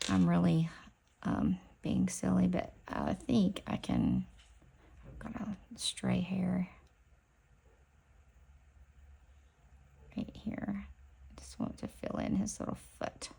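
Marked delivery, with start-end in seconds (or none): 5.1-13.3 whispering while doing fine brush work; hushed and careful, under her breath. Real, not acted. Filling in the bear's foot with a fine brush, careful whisper.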